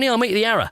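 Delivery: "Arrow" as ARR-uh; friend's dropped-h accent. Retold or imitated. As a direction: end "Arrow" on a weak uh, no h. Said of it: "He's going".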